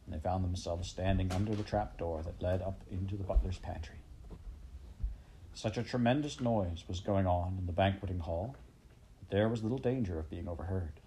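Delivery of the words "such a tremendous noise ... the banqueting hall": ordinary delivery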